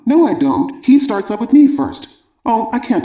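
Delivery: put-on a kid insisting it's not his fault; upset son. Son arguing back with father.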